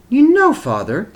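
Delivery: wife speaking to her husband at the picnic; a hint of a mother's voice. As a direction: turn to him with a sudden thought. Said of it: "she said suddenly".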